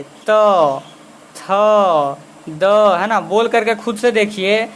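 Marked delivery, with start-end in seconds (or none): 0.0-2.6 त, थ, द each said as a clear, separate syllable; sounding out त थ द. Shown as a demonstration. Teacher showing students how त, थ, द are said, clearly and one by one. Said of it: "तो आप बोल करके देखिए".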